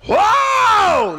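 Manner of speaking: huge high drawn-out "whoa"; wife's labor yell. Retold or imitated. Husband retells his wife's first labor pain, huge high yell. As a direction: howl it out at full volume. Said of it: "she made a yell like I've never heard".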